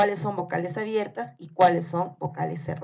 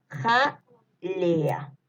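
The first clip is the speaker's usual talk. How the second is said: "Jalea" broken as ja, pause, lea; syllable-split dictation. Shown as a demonstration. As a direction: leave a clear gap after the first syllable of "Jalea". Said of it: "les voy a ir dictando". (dictado separando "Jalea" con pausa)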